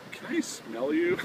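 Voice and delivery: high, slow, hammed-up drunk asking; imitating a drunk rock star's strange plea; mock drunk request. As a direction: ask it creepily, drawn out and high. Drunk Ricky's odd request.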